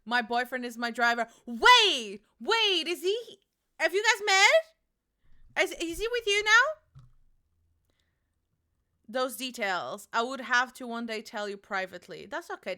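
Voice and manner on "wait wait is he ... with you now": high, loud excited questions; squealing at the news. Real, not acted. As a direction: gasp with eager curiosity. Excited reaction to boyfriend.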